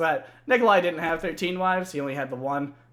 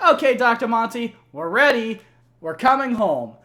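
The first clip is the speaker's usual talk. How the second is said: louder, higher announcing voice; hammed-up Richtofen; 5 semitones higher, 7 dB louder than usual. Richtofen telling Doctor Monty they are ready, hammed-up. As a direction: report to the boss proudly. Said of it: "and then is like".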